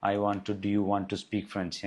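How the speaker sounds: very slow, word by word; slow dictation. Shown as a demonstration. Teacher reading out the prompt slowly as it is typed. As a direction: spell it out slowly while typing. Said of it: "ഒന്നുകൂടി ഇട്ട് നോക്കാം".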